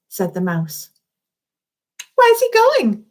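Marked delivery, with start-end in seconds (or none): 2.2-3.0 put-on much higher, louder worried child; anxious question. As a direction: Alia asks in alarm. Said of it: "asked Alia".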